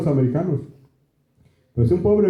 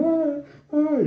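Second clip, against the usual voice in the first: mock high cries of pain, much higher; imitated cries; 11 semitones higher, 3 dB quieter than usual. Preacher mimics moviegoers crying out at Rocky, high mock yelps. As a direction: ape the women crying out at the film, high and thin. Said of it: "así gritaban".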